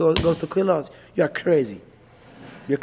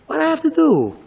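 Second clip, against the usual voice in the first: higher, pleading what I have to do; desperate question; 10 semitones higher than usual. Retold or imitated. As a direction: cry out for an answer.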